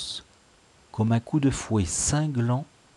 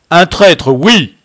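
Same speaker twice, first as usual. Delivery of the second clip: loud, raised thunderous confirmation; thundering; 7 semitones higher, 14 dB louder, slower than usual. A narrator's reading. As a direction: thunder it at the troop. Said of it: "tonna de rechef le Braque". (tonner avec autorité)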